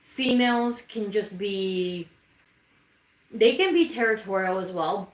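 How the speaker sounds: ordinary talk, nothing marked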